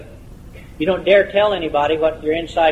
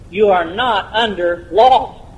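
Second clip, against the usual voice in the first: "You are not under law" word by word, very slowly; slow and emphatic; 2 semitones higher, slower than usual. Real, not acted. Preacher makes the congregation absorb it, slow, deliberate emphasis. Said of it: "let that soak in for a moment".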